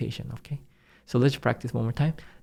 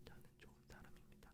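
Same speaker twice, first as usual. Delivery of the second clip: whispered; 37 dB quieter, much faster than usual. Shown as a demonstration. Silent mouthing for learners.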